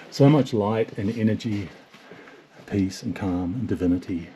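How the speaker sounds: normal talking voice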